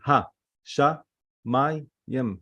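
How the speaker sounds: "Ha. Sha. Mayim." with a clear gap after each part; divided syllables. Shown as a demonstration. Teacher counting the parts of hashamayim, syllables separated. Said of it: "Hashamayim is three".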